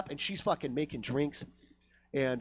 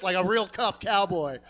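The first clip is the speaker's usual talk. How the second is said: ordinary talk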